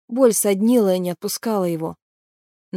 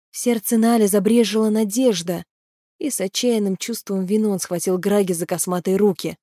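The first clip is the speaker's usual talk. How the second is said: ordinary delivery, nothing marked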